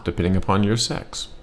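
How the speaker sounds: normal talking voice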